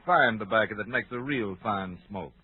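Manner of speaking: ordinary talk, nothing marked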